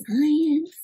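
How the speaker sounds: hushed, wondering whisper. Acted. Reader listing friends' talents to children, whispered. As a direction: whisper the last item of the list. Hushed last item of list.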